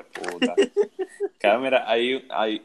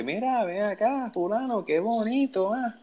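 mock teacher; 12 semitones higher, slower than usual. Teacher scolding in the story.

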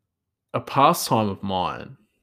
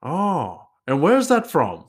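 high-pitched imitated Nepali accent, amused; 7 semitones higher, 4 dB louder, slower than usual. Nepali chef asks where Greek salad comes from, imitated Nepali accent. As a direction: mimic a Nepali chef's puzzled question in his accent. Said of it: "how I think he speaks".

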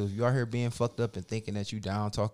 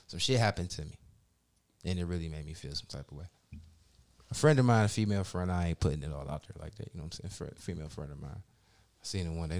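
usual speaking voice

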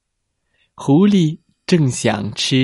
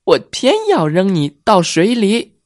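defiant fox; 7 semitones higher, 3 dB louder than usual. Acted. Fox throws tortoise.

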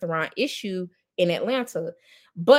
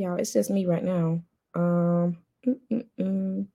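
ordinary talk

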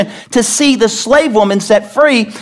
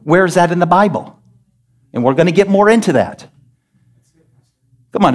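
normal talking voice

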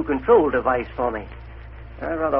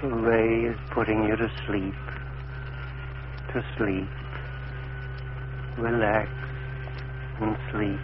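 soft, low, lulling; hypnotic murmur; 5 semitones lower, 9 dB quieter, slower than usual. Acted. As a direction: fade him gently into sleep. Hypnotizing patient with ray.

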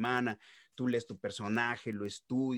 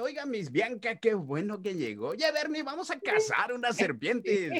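put-on northern Mexican accent; 11 semitones higher than usual. Jake greeting Miss Bianca and Bernie, northern Mexican cowboy accent. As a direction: play a cheerful norteño cowboy kangaroo in a northern Mexican accent. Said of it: "así habla como norteño".

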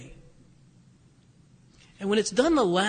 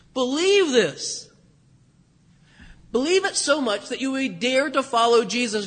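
rising pulpit exhortation; fervent exhortation; 3 semitones higher, 4 dB louder than usual. Acted. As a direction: urge them to follow, building to a plea. Preacher exhorts to follow Jesus.

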